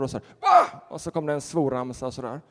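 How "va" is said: sudden high startled yelp; a jolted, squeaky what; shocked what. Retold or imitated. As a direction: blurt it out in disbelief, high and loud. Man's shocked reply to "pastor.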